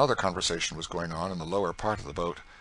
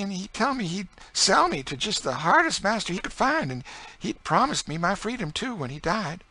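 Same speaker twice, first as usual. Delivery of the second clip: grieving enslaved woman; 13 semitones higher, 6 dB louder than usual. Acted. Enslaved woman telling Emmeline about her old master, high and mournful.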